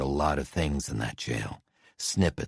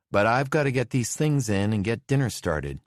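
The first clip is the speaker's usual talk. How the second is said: busy wife, higher; 7 semitones higher, 3 dB louder than usual. A narrator's reading. Wife hurrying in with groceries.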